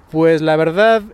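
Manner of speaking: ordinary talk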